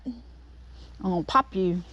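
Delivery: quiet threat. Real, not acted. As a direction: mutter the threat slowly under your breath. Threatening the bee.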